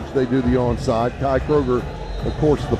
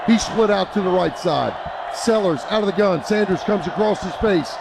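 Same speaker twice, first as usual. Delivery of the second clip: fast, raised radio play-by-play; live play-by-play; 5 semitones higher than usual. Real, not acted. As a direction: call the play as it happens.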